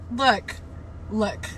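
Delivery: usual speaking voice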